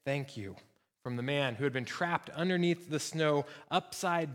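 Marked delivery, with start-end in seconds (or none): 0.0-0.6 lower, subdued thanks; a lightly voiced quiet reply; understated, hushed gratitude. A narrator's reading. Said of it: "he heard a quiet".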